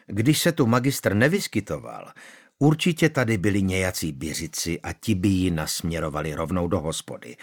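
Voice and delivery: usual speaking voice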